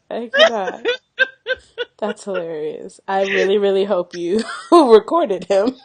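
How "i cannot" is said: laughing. Real, not acted.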